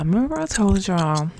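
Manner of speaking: very loud, pushed delivery. Shown as a demonstration. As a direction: belt it out like calling across a room. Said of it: "stop talking like that".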